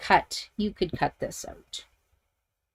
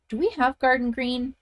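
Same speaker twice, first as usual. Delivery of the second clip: usual speaking voice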